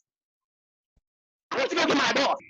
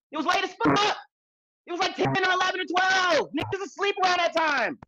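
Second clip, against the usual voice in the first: shouting; 7 semitones higher than usual. Real, not acted. Loud exclamation.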